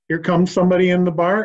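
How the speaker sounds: normal talking voice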